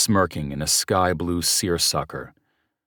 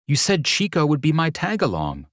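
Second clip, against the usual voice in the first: sharp higher comeback; retorting; 10 semitones higher than usual. A narrator's reading. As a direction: snap it back at him. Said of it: "she retorted".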